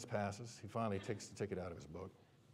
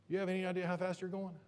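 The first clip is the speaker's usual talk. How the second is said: higher-pitched mock cop question; questioning patrolman; 9 semitones higher than usual. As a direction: lightly imitate a trooper grilling a driver. Imitated patrolman repeats his question.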